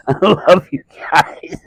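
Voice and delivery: slow, mock-choked whisper of gratitude; half-faked teary thanks to the crowd; tearful whisper. Comic overcome after crowd shuts heckler.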